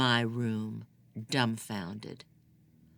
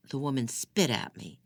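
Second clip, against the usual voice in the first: ordinary delivery, nothing marked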